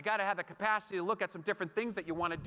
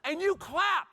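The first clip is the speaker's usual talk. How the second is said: normal talking voice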